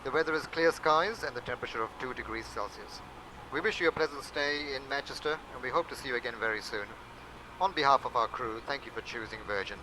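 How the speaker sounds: ordinary delivery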